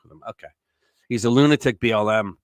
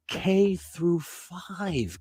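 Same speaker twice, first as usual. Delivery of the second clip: "K through five" drawn out very slowly and higher; incredulous emphasis; 5 semitones higher, 5 dB quieter, much slower than usual. Real, not acted. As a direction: stretch each word of "K through five" in disbelief. Incredulous repeat of what he read.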